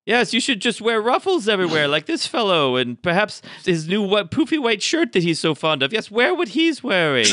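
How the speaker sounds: high-pitched, haughty talking mirror; scolding magic mirror. Acted. Magic mirror criticising an adventurer's outfit, high and scolding. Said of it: "the magic mirror is telling him".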